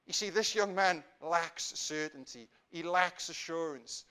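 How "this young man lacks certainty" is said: usual speaking voice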